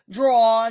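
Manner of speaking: "draw" said slowly, with no r on the end; her own pronunciation. Shown as a demonstration. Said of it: "if I pronounce a name wrong or my accent comes out wrong".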